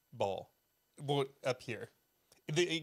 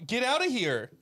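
louder, higher mock alarm; mock outrage; 5 semitones higher, 5 dB louder than usual. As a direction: throw someone out in pretend horror. Mock horror at spider bite.

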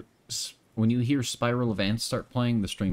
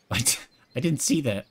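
surprised laugh; 5 semitones higher, 3 dB louder than usual. Real, not acted. Surprised by a game event.

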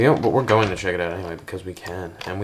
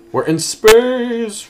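very high, loud, slow, stretched-out cry; hammed-up cartoon exclamation; cheesy game voice acting; 10 dB louder, much slower than usual. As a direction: overact it like a bad game voice. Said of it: "sounded like the voice acting skills of Paper Mario Guy".

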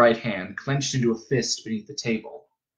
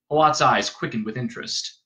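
plain reading voice

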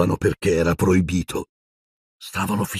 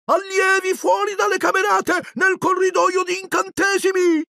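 poltergeist shout. A narrator's reading. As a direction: raise the alarm for the whole castle. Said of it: "cominciò a gridare Peeves". (Peeves grida l'allarme, voce acutissima)

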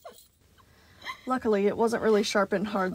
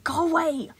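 higher, slow biting snap; sudden snap; 8 semitones higher, 4 dB louder than usual. Acted. Animatronic snapping at someone in his head, higher. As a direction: snap at an unseen voice. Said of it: "the animatronic snapped suddenly".

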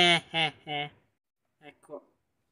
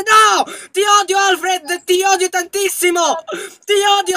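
playful angry yell; 5 semitones higher than usual. Real, not acted. Gamer yelling at Alfred who locked her out, playful fury. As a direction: yell it at your friend, half joking. Friend shut door, monster chasing.

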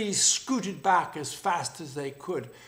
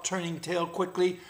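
plain reading voice

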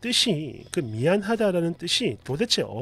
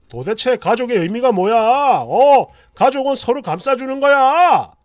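louder angry lecture; a husband shouting at his wife about family; barking husband; 3 semitones higher, 9 dB louder than usual. Retold or imitated. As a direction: yell about what family means. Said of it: "라며 짖습니다".

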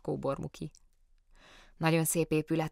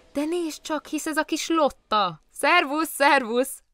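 put-on high, bright-eyed greeting of a child; light up as if you spot an old friend; a station master surprised to meet little Lotta, high and delighted; delighted surprise; 10 semitones higher, 6 dB louder, slower than usual.

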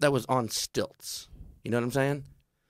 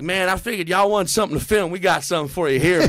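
louder, higher country voice; 6 semitones higher, 6 dB louder, faster than usual. Retold or imitated. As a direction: brag like a rowdy hunter. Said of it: "He's like".

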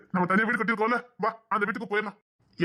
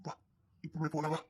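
much lower, soft, creeping tone; low menacing plea; 7 semitones lower, 6 dB quieter than usual. Acted. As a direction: coax her darkly, low and soft. Man turns menacing and low. (தாழ்ந்த மிரட்டும் குரல்)

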